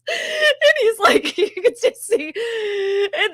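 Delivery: high, loud, slow words through real laughter; storyteller breaking into laughter while telling the story; laughing while telling. Real, not acted. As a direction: crack up so hard the words stretch. Teller cracks up mid-story.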